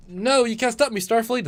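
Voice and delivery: loud, high cry of defiance; hammed-up villain taunting the captain; defiant villain. Acting the fleeing alien villain.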